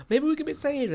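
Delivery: high-pitched gasping little plea; gasp, then squeak it out hopefully; mock desperate hope. Podcaster voices doomed prisoners spotting rescue, high mock-hopeful gasp. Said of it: "these people who are like".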